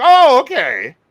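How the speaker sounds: high, loud "oh" of sudden understanding; retold realisation. Fan realising the payoff makes sense, high loud surprise. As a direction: act out the penny dropping. Retells reaction when it made sense.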